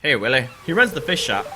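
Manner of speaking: ordinary talk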